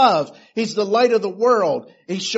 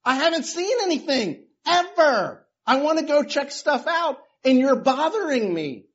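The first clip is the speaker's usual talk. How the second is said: mildly irritated man; 6 semitones higher than usual. Retold or imitated. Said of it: "the man's getting irritated".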